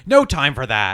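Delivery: loud, brusque imitation of an order; mock urgent shout. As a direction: shout it as the impatient woman. Retelling a woman cutting him off.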